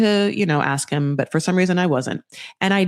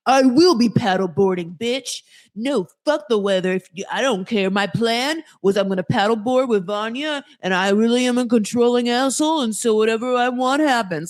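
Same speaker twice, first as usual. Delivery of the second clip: put-on stubborn tantrum, slightly raised pitch; mock controlling man; 5 semitones higher than usual. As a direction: imitate a man insisting on his plan. Mocking the controlling man's imagined rant.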